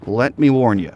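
ordinary talk, nothing marked

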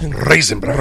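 "Raisin Bran" with rolled Rs. Shown as a demonstration. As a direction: trill the R at the start of "Raisin" and in "Bran". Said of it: "you have to roll the R".

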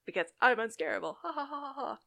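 lightly hammed-up bragging kid; mock arrogance. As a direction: crow it like a cocky little jerk, then mock-laugh. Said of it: "he's really arrogant about it".

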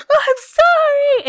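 high, loud, drawn-out wailed apology; hammed-up tearful wail; mock crying. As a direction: imitate yourself bawling an apology. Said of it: "And I started crying".

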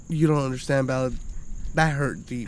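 low, subdued hurt voice; wounded. Retold or imitated. Dad tells his kid it hurt deep, wounded and subdued. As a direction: drop low and soft, as if wounded.

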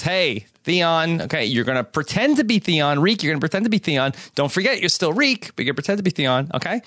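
rushed, fast explaining; fast mock instructions. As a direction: rattle it off quickly. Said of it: "Reek says".

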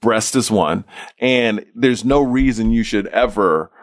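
low, measured, grave explanation; very serious doctor. Retold or imitated. Guest retelling his doctor's cancer warning, very serious. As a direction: lay it out low and steady, like a sober doctor. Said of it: "like, very serious".

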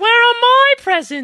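put-on high loud child's whine; child asking for presents; whiny kid. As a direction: demand it like a spoiled kid. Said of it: "we listened to a little bit".

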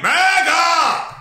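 high, drawn-out shout; mock rallying shout. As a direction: holler it like a rally chant. Mock cheering outburst mid-read.

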